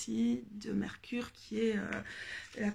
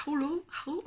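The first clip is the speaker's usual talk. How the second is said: English accent attempt; 5 semitones higher than usual. Shown as a demonstration. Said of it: "Mon accent anglais aujourd'hui, euh, c'est pas ça".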